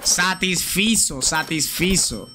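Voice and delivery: "Satisfizo, satisfizo" said slowly twice, falling each time; drilling the word for chat, slow; slow repeat. Shown as a demonstration. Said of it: "No es satisfacido, es satisfizo".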